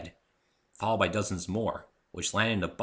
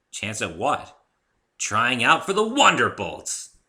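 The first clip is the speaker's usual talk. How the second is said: higher, louder tomboy pegasus, slow and proud; Rainbow Dash voice; 4 semitones higher, 8 dB louder, slower than usual. A narrator's reading. Rainbow Dash proudly tells the narrator her plan, high and loud. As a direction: announce it with swagger.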